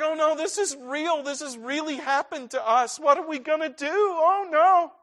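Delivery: high, frightened inner voice; panicked inner thought. Retold or imitated. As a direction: voice the child's sudden fear. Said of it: "it's like".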